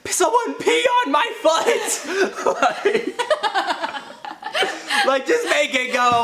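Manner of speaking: high squealed plea through laughter; laughing yell. Retold or imitated. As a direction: shriek it while cracking up. Friend's frantic plea, retold laughing.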